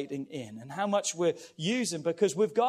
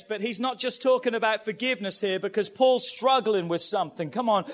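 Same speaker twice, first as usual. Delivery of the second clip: usual speaking voice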